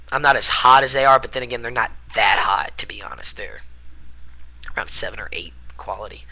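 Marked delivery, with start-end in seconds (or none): 1.2-6.2 hushed, unvoiced cheeky aside; whispered aside. Real, not acted. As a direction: whisper it behind your hand. Catty aside about MMA girls.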